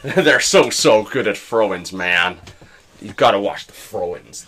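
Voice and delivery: loud, emphatic manager impression; Jesse Marsh. Podcaster mimics a football manager raving about throw-ins, loud impression. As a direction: push out the praise like a hyped-up coach. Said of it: "It's Jesse Marsh".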